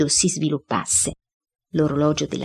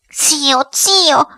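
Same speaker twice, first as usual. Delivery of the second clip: high cry, growing louder; exclaiming headmistress; 10 semitones higher, 5 dB louder than usual. A narrator's reading. Headmistress crying to her dead uncle, high and exclaiming. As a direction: call out twice, the second louder. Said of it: "esclamò la signora Geltrude".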